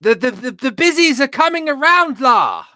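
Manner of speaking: a posh Englishman badly faking a Scouse accent; Boris Johnson doing Scouse. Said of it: "I'd love to hear Boris Johnson do a Scouse accent".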